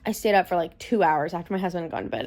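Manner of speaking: ordinary delivery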